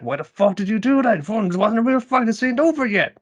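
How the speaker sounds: hammed-up outraged friend, pitch rising to the end; mock outrage. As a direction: push the betrayed fury up to a squeak. Friend outraged at pulled punch.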